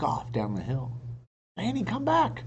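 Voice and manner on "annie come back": shouted. A narrator's reading. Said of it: "Jack shouted".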